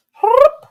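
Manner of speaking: very high loud chirp back at the bird; bird chirp imitation. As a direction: mimic the bird's squeak, high and loud.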